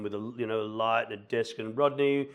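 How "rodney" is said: imitating an intimidating auditioner, louder and higher. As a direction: call out a mock-intimidating request from the auditorium. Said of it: "and being really intimidating".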